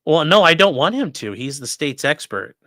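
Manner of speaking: normal talking voice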